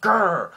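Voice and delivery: high, loud cartoon growl; mock growl. Buggy fuming at the guards, loud mock growl.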